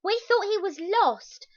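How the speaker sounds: put-on high, loud girl's voice; relieved girl talking to bin men; Bella's relieved voice. A narrator's reading. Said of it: "Bella told the bin men".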